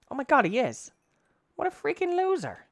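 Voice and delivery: very high, incredulous squeal; indignant disbelief. Real, not acted. Player finding that a creature laughs at them, high indignant scorn. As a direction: pitch it way up in outraged scorn.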